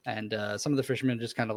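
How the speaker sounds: ordinary talk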